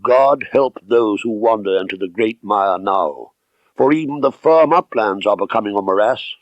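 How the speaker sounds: ordinary delivery, nothing marked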